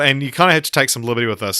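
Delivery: ordinary delivery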